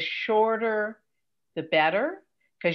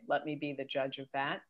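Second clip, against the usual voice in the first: dry retort; 4 semitones lower, 10 dB quieter than usual. Retold or imitated. Her dry retort, fading.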